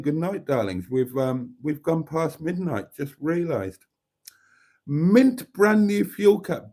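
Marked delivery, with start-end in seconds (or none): 4.8-6.7 high, slow and proud; excited. Real, not acted. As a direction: show it off with delight. Excited showing new cap.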